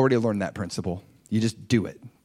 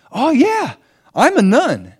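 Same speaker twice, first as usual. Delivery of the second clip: much higher, louder casual reply; breezy nun; 11 semitones higher, 7 dB louder, slower than usual. Retold or imitated. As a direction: toss it off like it's obvious. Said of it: "she's like".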